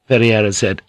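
usual speaking voice

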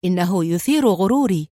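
understated mocking reply; sarcastic. A narrator's reading. Suspect brushing off the inspector's challenge, lightly sarcastic. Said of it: "وقال في سخرية".

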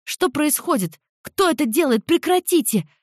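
high, rising panicked scream. Acted. A student freezing into stone cries out, panicked high scream. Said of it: "завопила девица впереди".

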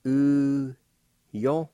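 "U, yo" said high and loud, syllable by syllable; teacher sounding "U, yo". Shown as a demonstration. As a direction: call out "U" then "yo" clearly. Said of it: "First part is pronounced like this".

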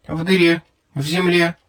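a small cold alien speaking through a translator; small creature voice. A narrator's reading. Alien creature answers via translator.

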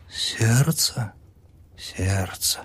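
patient whispering to the doctor; whispered. A narrator's reading. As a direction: breathe it sadly. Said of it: "прошептала она".

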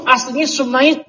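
"Sumait" said as su-mait, two clear syllables, not "Smith"; Arabic original name. Shown as a demonstration. Teacher revealing a name's origin: "Sumait" pronounced su-mait. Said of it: "Itu bukan Smith begitu".

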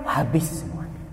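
hushed unvoiced habis semuanya; a hushed sad aside; whispered lament. Real, not acted. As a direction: let it fall away to a whisper. Preacher laments the loss.

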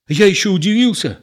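baffled and defensive; bewildered. A narrator's reading. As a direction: explain yourself, at a loss. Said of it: "растерянно говорил Егор".

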